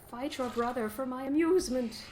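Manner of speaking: hammed-up evil master ordering his beasts; playfully voiced villain commanding one warg to attack another; mock villain command. Voicing villain commanding wargs.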